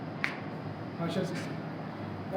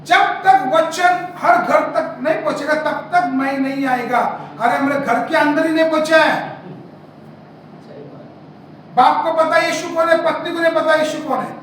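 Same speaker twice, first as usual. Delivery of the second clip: raised, emphatic scripture proclamation; impassioned pulpit challenge; forceful preaching; 6 semitones higher, 9 dB louder than usual. Real, not acted. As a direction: preach it out, stretching the opening phrases.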